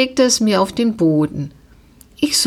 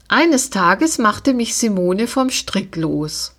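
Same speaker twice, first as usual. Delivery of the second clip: ordinary delivery, nothing marked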